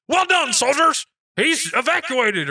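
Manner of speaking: high, loud mock military call; hammed-up toy commander addressing the troops; toy soldier. Toy soldier praises his troops after hiding, high loud call.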